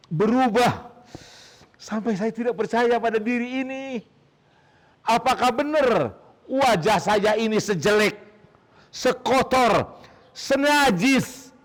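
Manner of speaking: a sufi horrified at his own face, high and drawn out; shocked disbelief. A narrator's reading.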